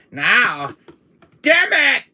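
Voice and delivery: high, loud frustrated yell; frustrated shout. Acted. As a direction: curse at full voice. Ex-wife arrives.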